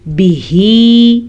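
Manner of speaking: slow, drawn-out tajweed chant; Quran recitation. Shown as a demonstration. Teacher reciting for students. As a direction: intone the Arabic slowly.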